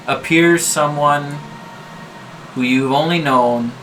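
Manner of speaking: ordinary talk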